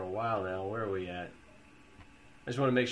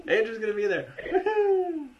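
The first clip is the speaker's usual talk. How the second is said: high, loud laughing whoop; excited cheer; 13 semitones higher, 6 dB louder than usual. Real, not acted. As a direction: cheer it out gleefully. Cheering about conference.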